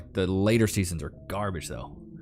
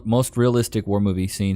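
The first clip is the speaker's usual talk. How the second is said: ordinary talk, nothing marked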